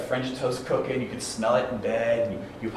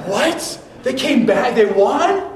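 high, loud astonished questions; can't believe they came back; stunned disbelief; 11 semitones higher, 9 dB louder, slower than usual. Retold or imitated. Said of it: "I'm like".

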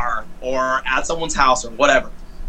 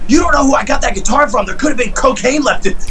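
high, louder, urgent mom voice; scolding mom; 10 semitones higher, 3 dB louder than usual. Retold or imitated. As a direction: warn the kid like a panicked mother. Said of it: "My mom's like".

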